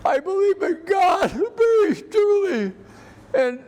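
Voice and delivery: real emotion spilling over as he speaks of faith; emotional voice breaking. Real, not acted. Veteran moved to tears telling the interviewer about his faith, voice breaking. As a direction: voice cracking high with feeling, half laugh half sob. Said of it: "And that comes out in your emotion".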